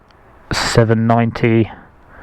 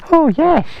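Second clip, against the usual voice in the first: squeaky praise for the puppy; 14 semitones higher than usual. Real, not acted. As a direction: coo it up high. High puppy talk.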